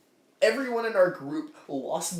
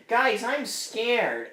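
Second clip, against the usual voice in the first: drawn-out, higher mock-timid plea; mock scared; 5 semitones higher, much slower than usual. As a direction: slowly imitate a frightened little character. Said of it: "he's like".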